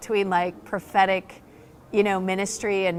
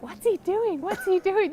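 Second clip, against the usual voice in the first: puzzled mock whisper; 10 semitones higher than usual. Friends puzzled watching Rob.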